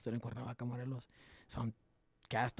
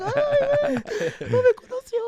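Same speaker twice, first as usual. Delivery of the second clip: very high, loud gleeful squeal; giddy squeal; 16 dB louder than usual. Retold or imitated. Sneaking past the CEO unrecognized, giddy squeal. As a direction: squeal like you got away with it.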